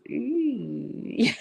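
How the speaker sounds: short high squeal demo; a quick playful squeal to show a yell; high little scream. Shown as a demonstration. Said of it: "that's screaming".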